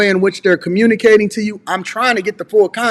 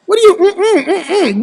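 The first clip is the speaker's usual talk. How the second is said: high-pitched mimicking mm-mm; mock the other's grunted no in a high voice; exaggerated sassy hummed no; mocking refusal; 10 semitones higher, slower than usual. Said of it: "What is that, a modern woman thing?".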